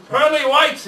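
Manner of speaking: exasperated pirate curse, high and loud; cursing. A narrator's reading. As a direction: spit it out like a foiled pirate. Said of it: "he cursed".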